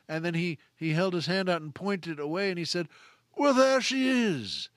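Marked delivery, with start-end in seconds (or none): 3.4-4.7 slow, high, grand stage reveal; Peter's theater voice. Retold or imitated. Actor unveiling a colleague in costume, grand theatrical reveal. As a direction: present the costume like a showman. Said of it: "what a great theater voice he had".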